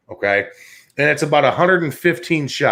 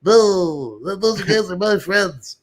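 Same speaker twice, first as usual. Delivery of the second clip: high, meek nerdy ranger voice, slower at the end; nerdy golf ranger; 5 semitones higher, much slower than usual. Retold or imitated. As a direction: play the timid little ranger pleading for his friends. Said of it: "the little nerdy golf ranger, he's like".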